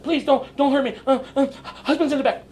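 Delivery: high, pleading mock panic; imitating a frightened woman begging; scared woman voice. Homeowner begging the visitor not to hurt her, high and scared.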